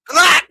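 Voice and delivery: high loud squawking quack; mock quack. Real, not acted. Mocking the victim on screen, loud high quack.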